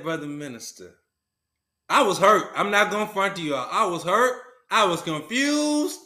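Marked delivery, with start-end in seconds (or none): 0.0-1.0 hushed reply. Retold or imitated. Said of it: "I said".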